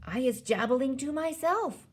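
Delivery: high-pitched, defensive reply; giant's answer. A narrator's reading. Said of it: "the B F G answered".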